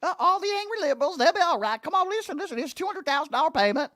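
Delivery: high, fast whiny mock voice; mocking James Comer. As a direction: squeak it out like a flustered politician brushing things off. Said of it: "This guy's so stupid".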